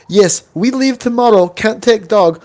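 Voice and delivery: put-on high, halting foreign-accented English; a hint of a foreign wife's broken English. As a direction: imitate the neighbor's wife lightly. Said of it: "the wife's like".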